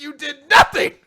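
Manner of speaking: indignant shout. Retold or imitated. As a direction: yell it in disbelief.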